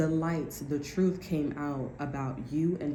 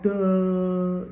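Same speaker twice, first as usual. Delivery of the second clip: mocking duh; 2 semitones higher, 7 dB louder than usual. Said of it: "They're like".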